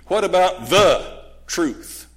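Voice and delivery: slow down and lean hard on "the"; stress on THE. Real, not acted. THE against my or your truth.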